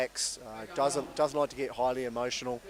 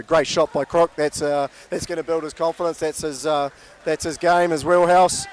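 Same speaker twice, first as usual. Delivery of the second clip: louder, lively cricket call; live play-by-play; 2 semitones higher, 9 dB louder than usual. Acted. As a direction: drive the ball-by-ball call.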